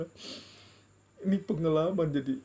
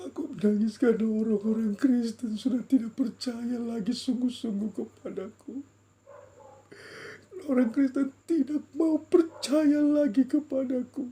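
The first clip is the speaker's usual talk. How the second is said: high, loud, tearful lament; speaking while weeping; 7 semitones higher, 5 dB louder than usual. Retold or imitated. God lamenting Christians' lost trust, weeping. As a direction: cry the words out in grief, building louder. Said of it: "Dia bicara sambil menangis".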